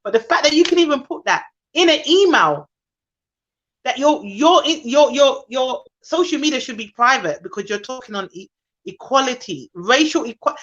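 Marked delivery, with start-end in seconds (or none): none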